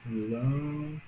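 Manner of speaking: Lâmpada slow and soft, first syllable held, then a break; split into syllables. Shown as a demonstration. Teacher separating a word while writing it, syllable by syllable. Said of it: "Opa, separar aqui".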